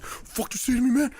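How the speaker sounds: high-pitched mock outrage; mock angry. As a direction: imitate an affronted stranger squaring up. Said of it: "the guy, like, got red in his face".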